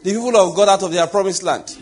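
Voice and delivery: loud fiery preaching; roaring rallying speech. Acted. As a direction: drive it home to the crowd. Preaching at full force.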